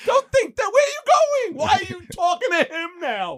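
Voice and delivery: very high, protesting voice; hammed-up desperate excuse to a girlfriend; frantic pleading. Acting out pleading with departing girlfriend.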